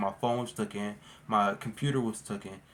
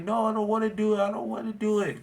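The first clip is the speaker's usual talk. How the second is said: scared pleading; 9 semitones higher than usual. Retold or imitated. Scared kid pleading with his parents, high. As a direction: imitate a panicked child begging. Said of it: "I was so scared".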